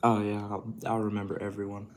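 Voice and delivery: normal talking voice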